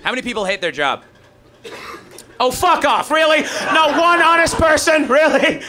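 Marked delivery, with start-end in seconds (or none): 2.4-5.6 higher, shouted really; mock-outraged comic at silent audience; loud disbelief. Real, not acted. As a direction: yell your disbelief at the crowd.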